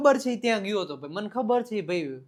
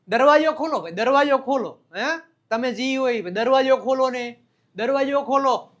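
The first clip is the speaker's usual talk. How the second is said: louder, higher-pitched call; 5 semitones higher, 8 dB louder than usual. Acted. Teacher acts asking someone to open the door, calling out.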